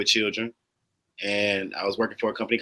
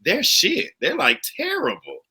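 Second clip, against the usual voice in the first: high-pitched, incredulous complaint about bad service; mock outrage. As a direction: imitate an appalled diner, pitched way up. Mimics judging bad servers while out.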